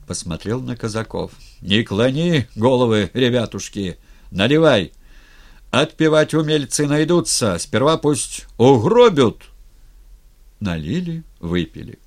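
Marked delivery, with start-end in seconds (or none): 1.6-9.4 rousing commander's shout; rally the men loudly, rising to a defiant peak; fierce, booming call to drink. A narrator's reading. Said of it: "сказал громко, остервенело".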